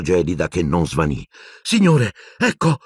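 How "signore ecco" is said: high, raised call; urgent yell. A narrator's reading. Boy bringing water to his professor, urgent yell. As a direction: yell it as help arrives. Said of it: "urlò".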